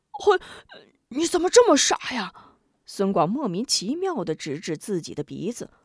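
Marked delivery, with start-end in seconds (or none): 0.1-2.3 high, fast irritated snap; annoyed retort. A narrator's reading. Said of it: "龙彪生气地一推孙广的手". (生气地数落，又高又快)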